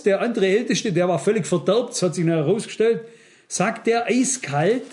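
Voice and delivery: usual speaking voice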